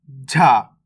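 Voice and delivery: झा pushed out with a breathy puff; forceful aspiration. Shown as a demonstration. Teacher shows learners the forced letter, झा strongly aspirated. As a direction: put force into the consonant of झा. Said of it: "झा को जोड़ लगा के पढ़ देंगे".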